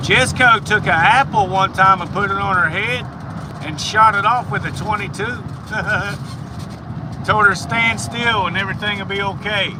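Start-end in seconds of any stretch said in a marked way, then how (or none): 5.3-7.2 amused little laugh; chuckle. Real, not acted. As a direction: chuckle at your own story. Amused by own story.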